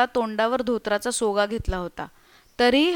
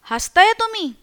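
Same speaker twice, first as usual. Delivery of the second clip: higher-pitched, mildly indignant question; a bit angry; 8 semitones higher, 3 dB louder than usual. A narrator's reading. Tukaram to the guest who laughs at his ghost story, a bit angry. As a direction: ask it slightly put out, pitch jumping up. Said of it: "थोडा रागावून म्हणाला".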